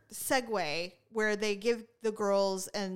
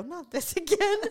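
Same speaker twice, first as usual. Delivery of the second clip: higher, louder fed-up complaint; loud exasperated groan; 8 semitones higher, 4 dB louder than usual. Retold or imitated. Bored at the recital, groaning aloud at another skit. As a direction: let it out too loud. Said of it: "And I said it kinda loud".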